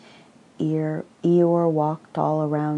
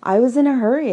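excited; 9 semitones higher, 9 dB louder, much faster than usual. Acted. Tigger's excited excuse.

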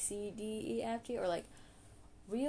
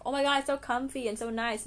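quoting mom in a higher, louder gush; delighted mom; 7 semitones higher, 9 dB louder, faster than usual. Retold or imitated. Daughter quoting mom loving new clothes, excited gush. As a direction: gush quickly over a present. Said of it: "because she thinks".

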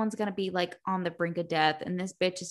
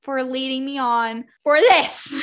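mock sweet then angry; 5 semitones higher, 6 dB louder than usual. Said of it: "I was like".

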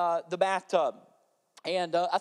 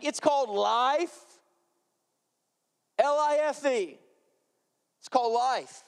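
preaching emphasis; 5 semitones higher than usual. Acted. Pastor hammering the point home to the congregation, emphatic. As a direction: land each word like a sermon hook.

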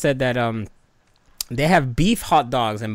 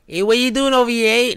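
loud, high New York-sounding holler; badly faked Boston accent, pitched up; botched Boston accent; 9 semitones higher, 4 dB louder than usual. Shown as a demonstration. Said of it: "No, that's still New York, right? ... I can't do Boston accents".

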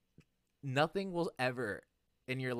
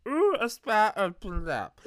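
high, loud, slurred drunk impression; 10 semitones higher, 8 dB louder than usual. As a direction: play yourself very drunk, voice pitched way up. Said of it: "This is me very drunk. I should change my voice".

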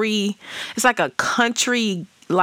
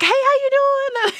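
much higher, bright hello to a newcomer; high friendly greeting. Retold or imitated. Son's mom greeting the new girlfriend, high and friendly. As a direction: greet her like an old friend.

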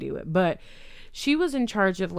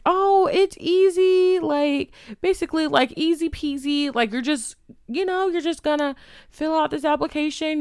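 breezy sales pitch; 7 semitones higher, 4 dB louder than usual. Retold or imitated. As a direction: imitate a recruiter making it sound like a breeze. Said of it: "making it seem like it's a breeze".

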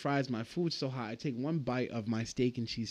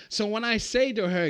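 ordinary talk, nothing marked

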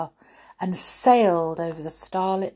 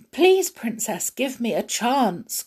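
high, earnest plea; pleading prince; 5 semitones higher, 3 dB louder than usual. Acted. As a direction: beg her for a chance. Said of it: "cried Prince Ali".

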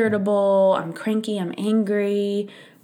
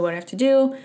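higher, more urgent second ask; anxious repeat; 6 semitones higher, 3 dB louder, much faster than usual. Retold or imitated. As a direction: press the question again, rattled. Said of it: "going through my checklist".

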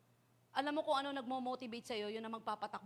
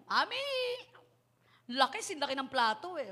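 put-on child showing Mami a big award; excited child; 3 semitones higher, 6 dB louder than usual. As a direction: burst in like a kid showing off a prize.

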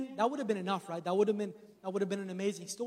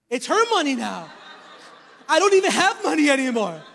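laughing loud and high; joking through laughter; laughing about having no money; 4 semitones higher, 10 dB louder than usual. Real, not acted.

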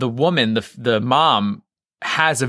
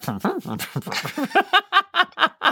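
high laugh. Real, not acted. Big laugh.

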